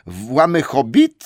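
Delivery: slow puzzled troll question; slow, baffled echo; surprised trolls. Acted. Trolls puzzled by Bilbo's word, slow and surprised. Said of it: "spytali trochę zaskoczeni".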